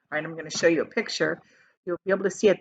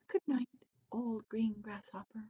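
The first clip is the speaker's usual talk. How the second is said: soft unvoiced whisper; drowsy good night whisper; 15 dB quieter than usual. A narrator's reading. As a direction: breathe it out like a sleepy boy. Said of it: "he whispered".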